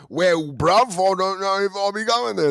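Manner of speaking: high, hammed-up British bloke; cartoonish British slang voice; put-on British accent. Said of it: "ride out that voice".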